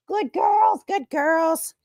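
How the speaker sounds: high sing-song praise for the dogs; pet praise voice. Real, not acted. Owner praising her dogs over their treats, high cooing pet talk. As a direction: coo it up high like praising a puppy.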